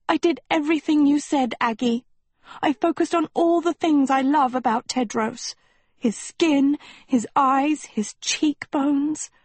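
put-on high girlish voice, lingering on the last words; lovestruck Sophie, self-justifying. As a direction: plead your case in a high, dreamy voice. Narrator plays lovestruck Sophie.

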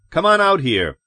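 snapped. A narrator's reading. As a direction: snap out a summons. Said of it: "Lois snapped".

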